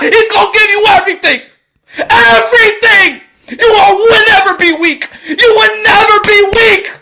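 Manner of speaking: loud, high, tearful preaching; crying out about God, voice breaking; emotional, near tears. Real, not acted. To his viewers about God, tearful shouting. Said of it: "I'm a little emotional right now".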